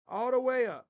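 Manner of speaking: pushed up high and loud. Shown as a demonstration. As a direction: crank it up with the voice. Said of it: "turn up the volume all the way up".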